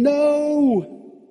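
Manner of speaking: high, loud terrified scream. Acted. Adam and Eve screaming at God behind the bunny, terrified. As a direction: scream it with eyes covered. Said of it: "covering their eyes and screaming".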